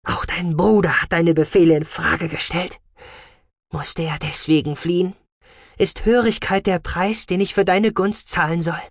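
high, offended challenge; indignant. A narrator's reading. Scientist challenges commander about his brother, indignant. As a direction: argue back with rising outrage. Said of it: "Die Wissenschaftlerin klang empört, beinahe beleidigt".

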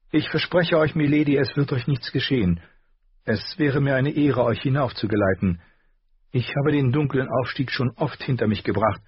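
put-on a girl bragging about the climb; boastful young girl. A narrator's reading. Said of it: "Sie klang so großspurig". (leicht großspuriges junges Mädchen)

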